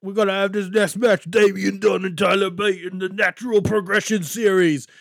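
put-on ring announcer delivery; mock announcer. Retelling the match announcement, imitation announcer voice.

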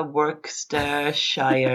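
normal talking voice

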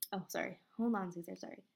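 ordinary delivery

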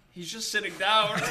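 laughing retold friend. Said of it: "And she's like".

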